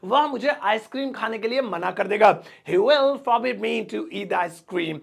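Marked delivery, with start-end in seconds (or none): 2.4-4.9 very slow, each word apart; slow model sentence. Shown as a demonstration. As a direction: dictate it slowly for learners. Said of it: "slow model sentence".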